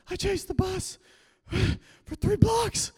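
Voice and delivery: mock exhausted kid; winded whine. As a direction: gasp it out after running. Exhausted reply to driver.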